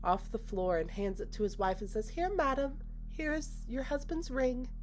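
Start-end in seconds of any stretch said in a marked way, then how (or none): 2.1-4.7 high, composed hostess voice, slowing at the end; calm, formal politeness. Retold or imitated. Hostess handing the wife her husband's ring, calm and high. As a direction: imitate a serenely polite hostess, pitched high. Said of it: "she just calmly picks up the ring".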